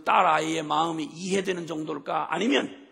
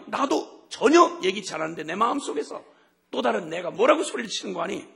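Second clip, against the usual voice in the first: normal talking voice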